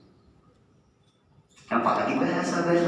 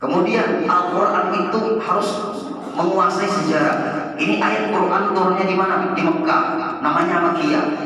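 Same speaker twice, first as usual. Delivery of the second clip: louder sermon voice driving each question; preaching at full force; 6 semitones higher, 6 dB louder than usual. Acted. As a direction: press the congregation with each point. Preacher builds again on Quran history. (berkhotbah lantang, menekan jamaah)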